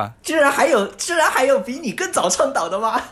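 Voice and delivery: high, loud, astonished question; surprised. Real, not acted. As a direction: genuinely caught off guard. Amazed there is an earlier advocate.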